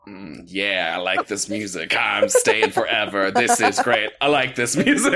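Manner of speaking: low lazy owl voice, lightly voiced; a contented owl, low and relaxed. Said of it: "the owl wakes up and it's like".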